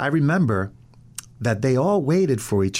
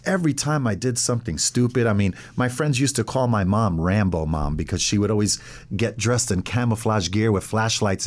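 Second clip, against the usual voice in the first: ordinary delivery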